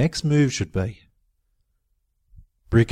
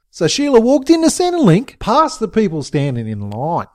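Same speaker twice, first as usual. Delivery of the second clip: ordinary delivery, nothing marked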